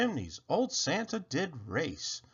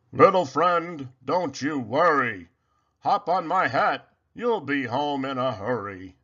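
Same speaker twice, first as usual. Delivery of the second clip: kind Santa; 4 dB louder than usual. Acted. Santa reassures the mouse.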